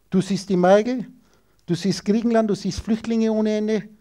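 normal talking voice

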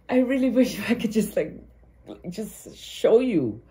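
high and halting, overcome with feeling; getting emotional. Real, not acted. Reader wishing she could show the listener, getting emotional. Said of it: "I'm getting emotional reading you".

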